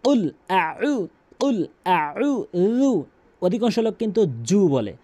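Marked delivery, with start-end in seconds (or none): 0.0-3.0 কুল আউযু stretched very slowly and carefully; correct slow recitation. Shown as a demonstration. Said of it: "শুদ্ধ করে এভাবে বলুন". (শুদ্ধ ধীর উচ্চারণ)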